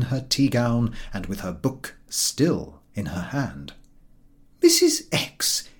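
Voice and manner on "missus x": high, slow, breathy gasp of a name; surprised exclamation. A narrator's reading. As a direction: call her name out in shock. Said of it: "exclaimed Missus Y".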